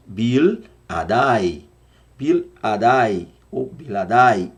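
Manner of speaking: "Bil Adai" repeated slowly; drawn-out Hebrew "Bil Adai". Shown as a demonstration. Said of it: "repeating the Hebrew".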